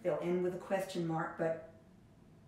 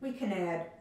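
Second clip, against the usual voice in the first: usual speaking voice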